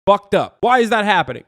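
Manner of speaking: outraged bystander, hammed up; mock outrage. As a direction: act scandalized, punching each word loudly. Acted outraged objector in hypothetical.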